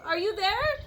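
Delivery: calling out. A narrator's reading. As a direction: call into the clover. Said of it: "and called".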